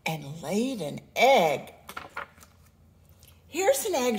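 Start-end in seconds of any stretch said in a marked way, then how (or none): 3.5-4.2 put-on excited boy's call, much higher; bright high shout. A narrator's reading. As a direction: call out the find to mother. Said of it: "shouted Jack".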